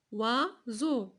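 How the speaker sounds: "Oiseau" split as OI, pause, SEAU; syllable by syllable. Shown as a demonstration. Teacher counting syllables for pupils, "Oiseau" chopped in two. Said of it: "Début ou bien la fin?".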